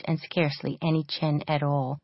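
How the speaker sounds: ordinary delivery, nothing marked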